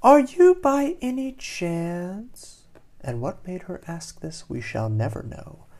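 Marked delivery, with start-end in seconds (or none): 0.0-2.6 high, slow, coy question; innocently prying; curious fish asking. A narrator's reading. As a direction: ask sweetly up high, drawing it out. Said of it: "Doreen asks him".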